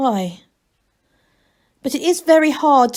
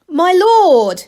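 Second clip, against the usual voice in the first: loud, higher call; loudly; 9 semitones higher, 9 dB louder than usual. A narrator's reading. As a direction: raise the voice to get the abbot's attention. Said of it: "he said loudly".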